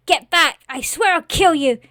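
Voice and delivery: high, loud shouted warning; frightened threat. Acted. Boy cuts off the scarecrow's plea, shouted threat.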